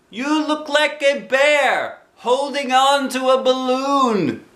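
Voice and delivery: put-on high, slow child voice; lightly voiced boy. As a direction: answer like a gentle little boy. Christopher Robin voiced.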